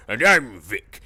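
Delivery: loud, same bald-man voice as Max; Vic voice. Retold or imitated. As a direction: punch it out like a villain. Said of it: "Victor von Victorstein is just Max Stone".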